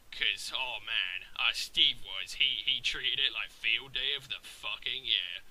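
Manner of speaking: usual speaking voice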